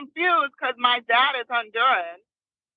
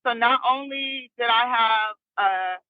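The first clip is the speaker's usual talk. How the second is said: ordinary delivery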